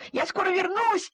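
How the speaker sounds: shouted. A narrator's reading. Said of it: "крикнул Тим".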